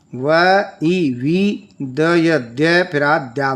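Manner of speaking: very slow, letter by letter; slow spelling out. Shown as a demonstration. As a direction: spell it out slowly for young pupils. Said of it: "spelling out syllables slowly".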